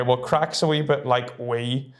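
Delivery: usual speaking voice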